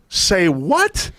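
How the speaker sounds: a touch of shocked disbelief; nurse's startled reaction. Retold or imitated.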